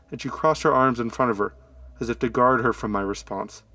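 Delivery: ordinary delivery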